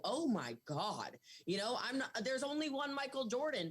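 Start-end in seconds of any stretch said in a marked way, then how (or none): 0.0-1.2 awed. Retold or imitated. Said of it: "I go".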